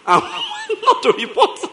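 laughing through the words. Real, not acted. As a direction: crack up mid-sentence. Laughs at own joke.

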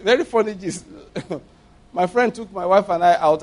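normal talking voice

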